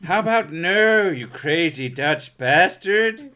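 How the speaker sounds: hammed-up movie quote, stretching the insult; film character impression. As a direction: refuse with exaggerated comic scorn. Quoting an Austin Powers film line.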